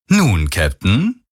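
drawn out, rising, falsely friendly address; slow, smooth false friendliness; deceptively friendly. A narrator's reading. Stranger calls the captain over the comm, falsely friendly. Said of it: "die trügerisch freundliche Stimme".

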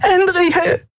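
high urgent call of her name. A narrator's reading. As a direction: cry out her name in alarm. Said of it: "hörte sie Nelen rufen".

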